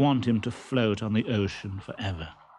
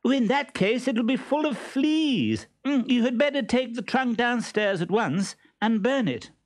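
put-on high girlish voice; 12 semitones higher than usual. Columbine playing along for listener.

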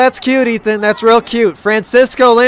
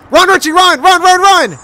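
high, excited cheer for the runner; excited urging; 6 semitones higher than usual. Real, not acted. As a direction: urge them to run, pitched up.

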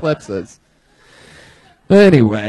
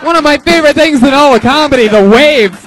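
loud, high, hyped-up announcing; hype host; 7 semitones higher, 8 dB louder than usual. Real, not acted. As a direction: pump up the crowd. Host hyping the roast battle.

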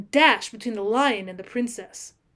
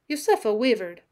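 ordinary delivery

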